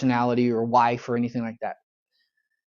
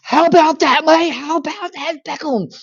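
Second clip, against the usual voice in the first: high, loud jubilant English bloke; a stranger in the street gloating over Beckham's goal, high and loud; excited English fan; 13 semitones higher, 7 dB louder than usual. Retold or imitated. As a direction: crow it at a stranger like a thrilled football fan. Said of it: "this guy leans over to me and says".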